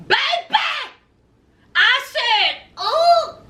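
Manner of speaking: high-pitched delighted shock, held on the vowels; scandalized exclamation. Real, not acted. Host reacting to Tabitha's comeback, high scandalized exclamation. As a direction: whoop it like you just saw a knockout.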